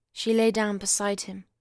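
plain reading voice

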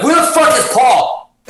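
high, loud, slow exasperated shout; exasperated yell. Retold or imitated. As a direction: bellow it in disbelief at the missing manager. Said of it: "I'm like".

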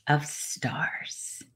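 "of Stars" said in a whisper with no voice. A narrator's reading. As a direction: whisper "of Stars" softly, barely breathing it. Title whispered at the end.

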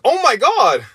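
high-pitched panicked exclamation; alarmed. Retold or imitated. Stepbrother spots the bloodied skateboarder, alarmed. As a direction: mimic a horrified onlooker.

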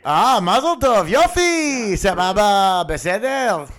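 put-on high, lively Israeli accent voice. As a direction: play a cheerful Israeli with an Israeli accent. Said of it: "your Israeli voice".

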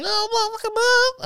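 mock the kid's high voice in a squeak; mocking high pitch. Schoolyard bullies mock his voice in a high falsetto squeak. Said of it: "they'd go straight to the high pitch".

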